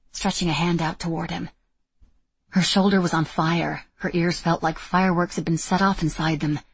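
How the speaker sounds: usual speaking voice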